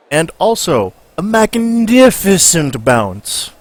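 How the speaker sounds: loud, high, slow newsreel narrator; 1930s narrator. Acted. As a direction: boom it like an old newsreel announcer. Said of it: "nineteen thirties voice is coming out".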